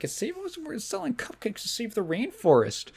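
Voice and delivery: put-on high, sugary nice-girl voice; mock sweet girl. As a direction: play the fake-friendly do-gooder.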